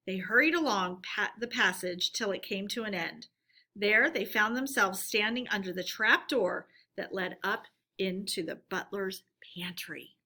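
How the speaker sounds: usual speaking voice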